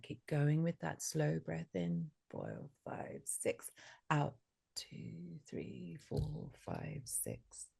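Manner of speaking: soft, slow breath count, near whisper. Shown as a demonstration. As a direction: count the breaths slowly, hushed. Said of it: "I'm gonna count your breath".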